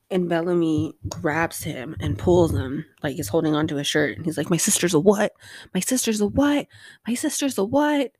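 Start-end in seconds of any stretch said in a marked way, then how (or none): 4.5-8.1 each "what" climbs higher, louder at the start; building outrage. Acted. Brother grabbing Murphy, climbing from tense to shrill disbelief. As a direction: repeat it, getting more incredulous each time.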